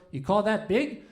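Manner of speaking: usual speaking voice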